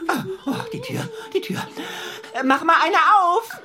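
startled cry. Acted. Startled at the door.

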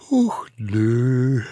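high, drawn-out yawned refusal; yawning. A narrator's reading. Smallest savage declines to gather grass, yawning. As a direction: yawn right through the words. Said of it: "gähnte der Allerkleinste".

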